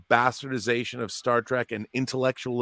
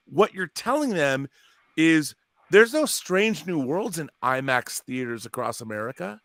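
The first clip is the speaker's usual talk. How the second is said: ordinary talk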